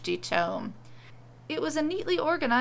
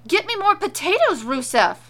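high, loud shriek of a demand; 9 semitones higher, 10 dB louder than usual. A narrator's reading. Witch wife shrieking at husband for potatoes, loud shriek. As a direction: scream the order at him. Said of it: "she shrieked".

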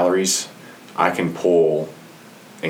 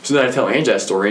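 ordinary talk, nothing marked

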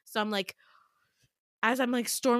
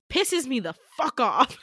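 loud, punched-out irritation; exasperated outburst; 4 semitones higher, 8 dB louder than usual. A narrator's reading. Narrator reacting to his sudden gentleness, exasperated outburst. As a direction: burst out with each word hit hard.

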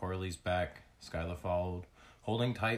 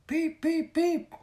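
very high, loud chirps; three squeaky, high-pitched cheeps; baby creature peeping; 9 dB louder, slower than usual. A narrator's reading. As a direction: peep like an excited little bird. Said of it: "Blaze said".

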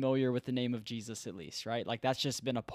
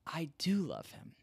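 soft, slightly raised, mildly tearful; understated retold weeping, hushed; retold tearful confession; 3 semitones higher, 6 dB quieter than usual. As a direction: imitate a friend quietly admitting it through tears. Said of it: "he just starts weeping".

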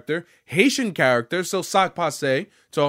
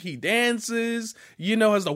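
ordinary talk

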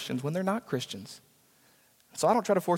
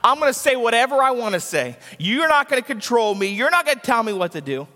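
loud, higher defiant voicing of a scoffer; defiant quote; 5 semitones higher, 4 dB louder than usual. Pastor voicing a scoffer defying God, loud and defiant. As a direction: throw it back at God like a defiant rebel. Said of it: "thumb their nose at God and say".

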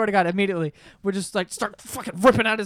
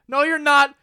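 mock defiant kid; 6 semitones higher, 8 dB louder than usual. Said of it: "And he's like".

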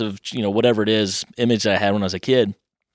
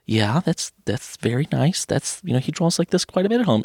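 gentle voice climbing higher; sweet mom; 6 semitones higher than usual. Retold or imitated. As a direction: play a sweet, polite mother. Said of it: "the sweetest lady ever".

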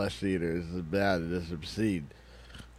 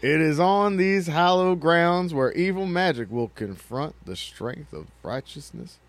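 higher, louder trailer announcer; booming movie trailer climax; epic trailer voice; 8 semitones higher, 6 dB louder than usual. Acted. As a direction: go big on the climax.

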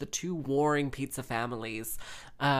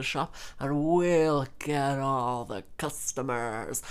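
slow, drawn-out mock villain plotting; imitating a plotting dad, slowly; scheming family dad. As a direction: stretch the key words like a hammed-up scheme. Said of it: "the warring family daddy is like".

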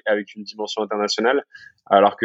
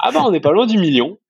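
étonné; 10 semitones higher than usual. Retold or imitated. Retells his own sudden realisation.

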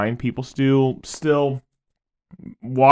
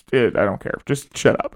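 lower, softer mock indifference; 5 semitones lower, 6 dB quieter than usual. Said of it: "most of us are just like".

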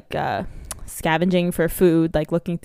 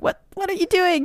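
high-pitched mock panic; hammed-up incredulous question; alarmed disbelief; 13 semitones higher than usual. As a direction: squeak it out as a horrified kid would, playfully. Retold shock at Elsa showing up.

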